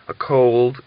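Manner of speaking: plain reading voice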